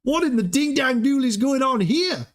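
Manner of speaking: high, hammed-up yokel exclamation; mock Aussie outburst. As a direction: squeal it out in a cartoonish voice. Imitating Brenton checking his portfolio.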